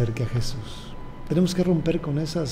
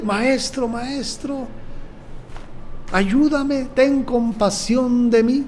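higher, louder plea for help; louder pleading cry; 8 semitones higher, slower than usual. Retold or imitated. Bartimeo crying out louder to Jesus for mercy, higher pleading call. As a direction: call out more strongly, begging. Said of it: "y luego más fuerte".

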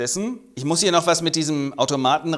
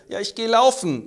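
character voice; 8 semitones higher, 4 dB louder than usual. Acted. Acted character voice.

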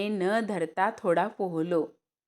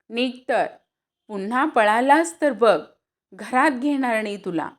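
stern warning; 4 semitones higher, 5 dB louder than usual. Acted. Mother sternly sends boy off.

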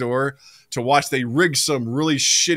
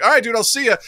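lightly voiced pal, pitched high; breezy high sign-off, imitated; casual buddy goodbye; 8 semitones higher, faster than usual. Friend leaving with the ladder, mock-casual.

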